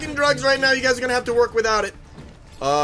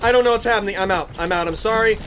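usual speaking voice

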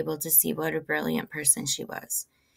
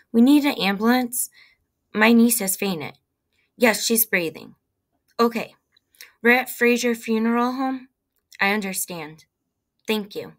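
raised, louder, urgent phone report; insistent, higher-pitched plea for help; urgent emergency call; 5 semitones higher, 7 dB louder than usual. A narrator's reading. Acted emergency phone call for ambulance.

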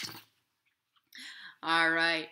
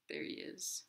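whispered aside; 10 dB quieter than usual. Real, not acted. Storyteller whispering to children where the inchworm is. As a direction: point him out in a hush.

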